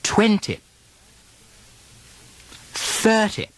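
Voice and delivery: crisp British RP words for the class to repeat; British RP demo. Shown as a demonstration. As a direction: model each word in British RP. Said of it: "More words".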